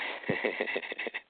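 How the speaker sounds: voiceless laugh; short laughing fit without words. Real, not acted. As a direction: laugh at the caller's remark. Laughs at being given permission.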